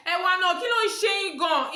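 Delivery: high, loud, fast Yoruba; heated challenge. Retold or imitated. Fed-up passenger confronting conductor, heated challenge.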